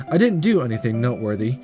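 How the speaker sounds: normal talking voice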